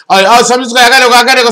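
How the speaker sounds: mock flustered man rushing off the call; panicky escaping man. Imitates Libra man fleeing conversation.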